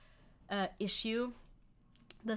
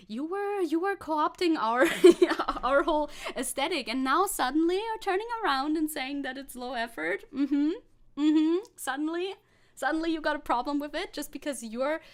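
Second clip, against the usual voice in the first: rising, louder mock outrage; indignant rant; 5 semitones higher, 6 dB louder than usual. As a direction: call him out, half laughing. Mock outrage at VTuber critic.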